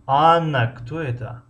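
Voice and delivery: Анна drawn out, high and loud, кто это dropping off; stress on the first word. Shown as a demonstration. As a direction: lean hard on the opening Анна. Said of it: "баланд талаффуз мекунем".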